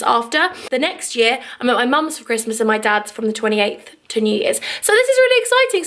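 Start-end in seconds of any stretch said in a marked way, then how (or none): 4.8-5.8 much higher excited lift; higher excited exclamation; excited burst. Real, not acted. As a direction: gush with excitement. Excited about family day.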